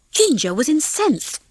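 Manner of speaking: ordinary delivery, nothing marked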